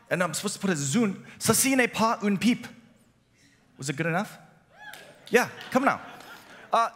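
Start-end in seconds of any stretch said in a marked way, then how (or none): 1.4-2.7 high-pitched learner's French accent; amateur French. Shown as a demonstration. Preacher retries French caption for congregation, amateur French accent. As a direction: have a game go at the French. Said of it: "pardon my French, I don't speak it".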